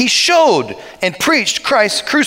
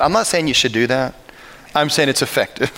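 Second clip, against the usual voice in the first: ordinary talk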